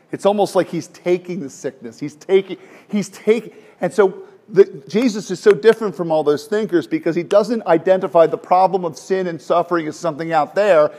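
usual speaking voice

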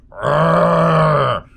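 bad actor's fake rage growl; hammed-up angry growl. Shown as a demonstration. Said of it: "This character is angry".

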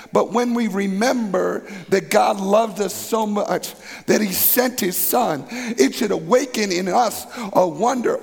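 rising, impassioned preacher; preaching at full force. Acted. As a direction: build it up with fervor. Climactic preaching.

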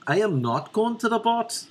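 high, sing-song Belfast lilt; exaggerated Belfast accent, pitched well up. Shown as a demonstration. Comedian finally landing the accent, high Belfast lilt. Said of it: "There we got the accent".

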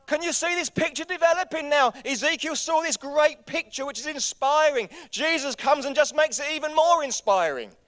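high, loud, excited sermon voice; full-force preaching. Real, not acted. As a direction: drive it home to the congregation.

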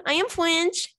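high, hammed-up French accent; silly high-pitched fake French; mock French accent. Host introducing a French round of the game, mock French accent.

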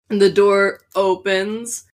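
ordinary talk, nothing marked

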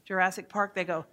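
usual speaking voice

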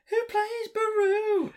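squeaky high-pitched repeat of the question; high silly voice. Acted. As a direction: ask it again in a cartoonish falsetto.

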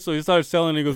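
ordinary delivery

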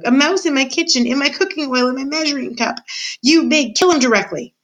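high-pitched indignant ordering; outraged cook. A narrator's reading. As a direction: build your outrage, then order the kill. Cook outraged by mouse in kitchen.

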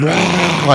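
loud mock beast roar; high, loud imitation of a snarling dog; crazy werewolf roar. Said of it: "he goes full crazy werewolf on her".

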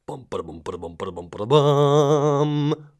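imitated fanfare drum; vocal drum roll. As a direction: build a spoken drum roll to a long last boom. Vocal drum roll before guest.

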